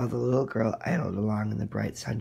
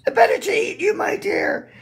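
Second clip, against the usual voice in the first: very high, loud shrieking roar; wolf's pounce; 11 dB louder than usual. Acted. Wolf leaps at the girl with a loud high roar. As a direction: burst out as the wolf attacks.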